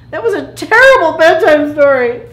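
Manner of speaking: high, loud protest; indignant outburst. Acted. Plankton objects to Crabby's ending, loud indignation.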